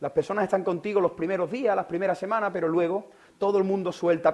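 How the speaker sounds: ordinary delivery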